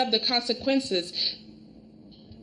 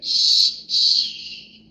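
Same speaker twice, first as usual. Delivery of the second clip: long, voiceless shh; shushing. Retold or imitated. Wife silencing Brooke in a recap, insistent shushing. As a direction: hush someone firmly with drawn-out shushes.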